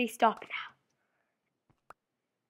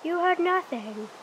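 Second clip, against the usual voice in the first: higher, louder and slow, a hammed-up sinister voice; slow, hammed-up creepy warning; mock ominous warning; 7 semitones higher, 5 dB louder, slower than usual. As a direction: spook him with a drawn-out threat. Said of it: "They'll be like".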